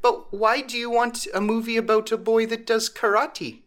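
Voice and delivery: high, puzzled grandma question; high, bewildered old lady. Retold or imitated. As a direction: sound baffled like a grandma. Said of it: "she's like".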